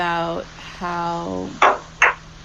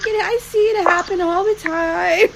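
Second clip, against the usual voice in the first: much higher, a touch louder stranger voice; imitating a kind stranger; 10 semitones higher, 4 dB louder than usual. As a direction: brush it off kindly, pitched up. Kind stranger consoling embarrassed mom.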